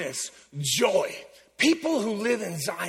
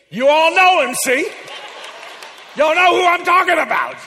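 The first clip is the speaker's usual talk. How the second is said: excited call-out; 8 semitones higher, 7 dB louder than usual. Real, not acted. Laughing call to the congregation.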